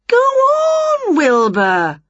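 very high, stretched "Go on" falling on the name; urging. A narrator's reading. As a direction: coax insistently, starting way up high. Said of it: "urged Winnie".

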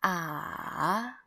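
drawn-out "Ah" going down, then up; valley-shaped glide on "Ah"; third tone. Shown as a demonstration. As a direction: sink "Ah" and lift it to the end. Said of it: "Go down and then go up".

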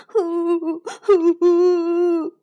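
loud tearful huffs; crying breaths; sobbing pants. Acted. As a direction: huff out sobs while running. Running home crying.

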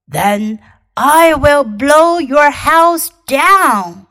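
slow, loud big bad wolf. Wolf threatening the pigs.

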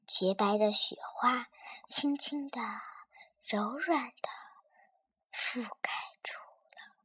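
fading to a breathy whisper. Acted. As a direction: let the voice vanish softly. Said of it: "你的声音都没有了".

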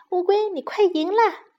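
plain reading voice

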